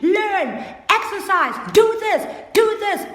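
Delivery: mock nagging parent shouting; nagging parent commands. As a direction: bark orders at a lazy child. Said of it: "keep motivating your children".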